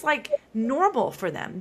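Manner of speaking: ordinary delivery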